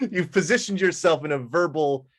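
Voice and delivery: talking through laughter; laughing. Real, not acted. GM teases player about hard names, laughing. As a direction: laugh while teasing the player.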